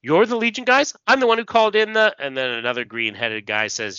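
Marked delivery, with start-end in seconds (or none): 0.0-2.1 high, flustered informant; Reddick. Acted. Informant explains himself to Legion officers, high flustered voice. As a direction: babble your excuse, speeding up. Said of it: "Reddick says".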